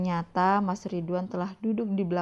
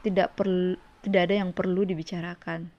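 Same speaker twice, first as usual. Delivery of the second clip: ordinary delivery, nothing marked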